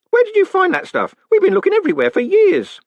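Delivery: put-on very high, loud, excited old postman. A narrator's reading. Groat amazed at the found uniform, excited. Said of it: "said Groat excitedly".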